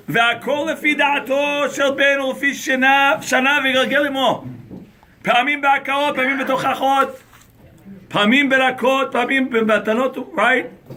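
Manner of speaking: text recitation. Acted. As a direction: recite the source briskly. Reads Hebrew source.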